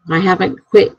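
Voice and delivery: ordinary delivery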